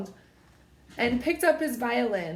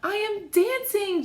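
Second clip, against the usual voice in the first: much higher, ecstatic exclamation of joy; joyful cry; 10 semitones higher than usual. Acted. Gerald crying out with joy while dancing, rising higher. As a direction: burst out with delight, building up. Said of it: "Gerald cried".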